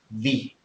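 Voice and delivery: "the" said as a stressed "thee"; emphatic "thee". Shown as a demonstration. Teacher showing the emphatic "thee" to a student. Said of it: "If you want to be emphatic, you say".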